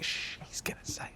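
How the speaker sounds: unvoiced whisper of anticipation; excited hushed whisper. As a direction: shush them and whisper it, as if waiting for the punchline. Imitating family hushing each other in anticipation.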